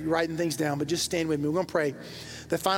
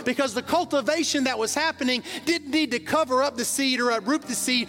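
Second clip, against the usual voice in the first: preaching at full force; 6 semitones higher than usual. Acted. Pastor preaching to the congregation about words, full-force fervour. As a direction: lift the church with rising fervour.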